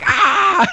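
very high mock scream; high drawn-out "aah" imitating a yell; imitated yell. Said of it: "I was yelling".

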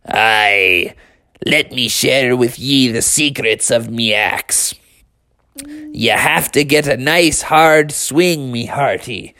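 drawn-out storybook pirate; pirate talk. Acted. Pirate dad teaches his son axe secrets, pirate voice. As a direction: share a secret as an old sea dog. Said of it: "you're not actually a pirate".

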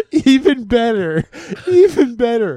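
teasing build-up. Real, not acted. Husband hyping the punchline to his friend, excited. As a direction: promise the best part's coming.

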